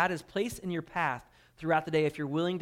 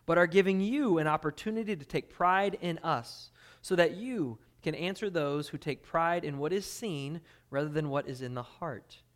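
ordinary talk, nothing marked

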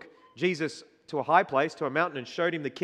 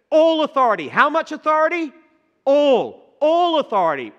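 "All" hit high and loud each time; a preacher drumming home one word; stress on ALL; 10 semitones higher, 9 dB louder than usual. Acted. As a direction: pound the word "All", louder and higher.

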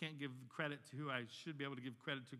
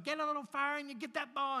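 high, raised-voice imitation of a yelling coach; coach's yell; 9 semitones higher, 4 dB louder than usual. Preacher imitating coach yelling to six-year-olds, hollered. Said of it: "he used to yell it to".

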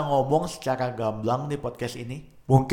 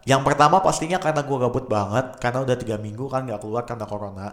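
ordinary talk

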